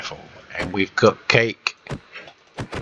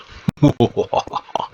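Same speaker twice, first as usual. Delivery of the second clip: evil laugh; 6 semitones higher, 3 dB louder than usual. Acted. Evil genius laugh at own design.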